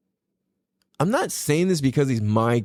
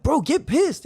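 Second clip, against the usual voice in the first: much higher, urgent push; retold hype-up; 13 semitones higher than usual. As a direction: egg the son on. Urging son to get angry.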